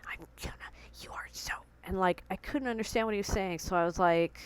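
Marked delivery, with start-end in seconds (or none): 0.0-1.5 a hissed, breathless whisper; hissing. Retold or imitated. Dad hissing at daughter after his voice gives out. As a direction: hiss it like a voiceless angry old man. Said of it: "hissing at me".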